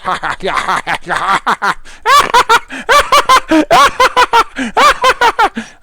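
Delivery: long, high, loud cackle in bursts; maniacal laugh. Pretend. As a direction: cackle like a gleeful villain. Mock killing frenzy.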